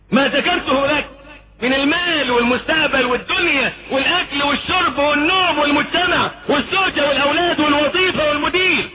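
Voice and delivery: high, fervent preaching; preaching at full force. Acted. Preacher lists idols crowding the heart, fervent high preaching. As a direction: drive the list home with fire.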